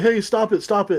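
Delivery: high urgent retold yell; shouting. People yelling at the creature to stop, mild mock shout. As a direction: call out to make it stop.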